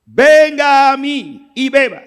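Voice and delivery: high, loud, slowed proclamation; preacher voicing Jesus crying out to the thirsty crowd; Jesus shouting. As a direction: call out to everyone who is thirsty. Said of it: "Jesús tiene que gritar".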